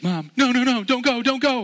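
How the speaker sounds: put-on high, loud pleading child; panicked child. Boy begging his mother not to leave, high loud panic. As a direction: wail like a small boy begging his mom. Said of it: "He looked over his shoulder and was like".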